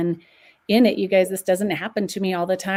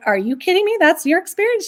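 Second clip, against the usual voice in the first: astonished; 8 semitones higher, 6 dB louder, faster than usual. Retold or imitated. Retold disbelief at friend's story.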